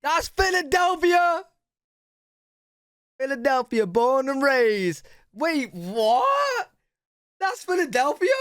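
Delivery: high, drawn-out astonished exclamations; loud disbelief. Real, not acted. Stunned by the revealed answer.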